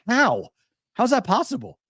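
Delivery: high-pitched, incredulous questions; retold bafflement. Co-host asking Robert about the stroke patient, incredulous. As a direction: replay being utterly baffled.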